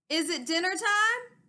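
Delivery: loud hillside call up to a friend; loud sheep calling across the hill; bellowed. Acted. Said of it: "he bellowed".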